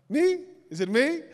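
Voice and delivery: high, delighted disbelief; surprised. Retold or imitated. Picked by the prophet, he asks in high surprise. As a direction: squeak out a thrilled "me?".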